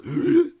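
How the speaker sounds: sharp loud high gasp, imitating drowning; imitated gasp. Imitating friend sucking toilet water, sharp loud gasp. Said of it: "it sounded like".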